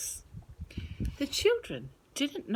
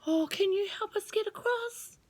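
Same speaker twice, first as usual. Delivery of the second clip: put-on much higher girl voice; little girl Rose; 12 semitones higher than usual. Rose asking the worm for help, high and hopeful. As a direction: ask hopefully like a small girl. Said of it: "asked Rose".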